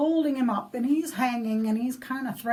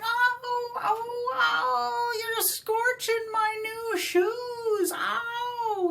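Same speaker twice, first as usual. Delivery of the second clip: much higher, squealing yelps of pain; pained leprechaun yelps; 12 semitones higher, much slower than usual. Acted. Leprechaun dangled over boiling water, high squealing yelps. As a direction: squeal like his feet are burning. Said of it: "And he's going".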